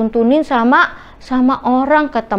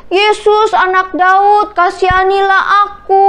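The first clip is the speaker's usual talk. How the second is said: acted beggar's shout, pitched up; pleading shout; 7 semitones higher, 3 dB louder than usual. A narrator's reading. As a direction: call out to someone far off, stretching the words. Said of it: "dia teriak lagi".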